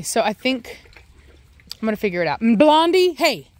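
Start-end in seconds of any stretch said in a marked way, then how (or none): 2.4-3.5 sharp raised call of the name; calling the dog. Real, not acted. Owner calling her dog by name, loud. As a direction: call the dog's name across the yard.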